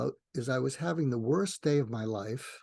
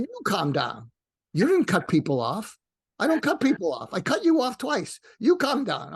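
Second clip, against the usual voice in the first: higher and louder, frantic pushback; retold shouting back; 7 semitones higher, 5 dB louder than usual. Driver telling him to calm down, he snaps back loudly. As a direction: throw it right back at him.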